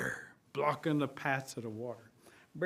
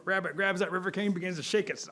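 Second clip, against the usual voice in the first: plain reading voice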